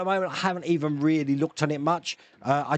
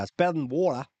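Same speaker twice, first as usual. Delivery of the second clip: put-on Australian accent. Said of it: "speaking like an Australian".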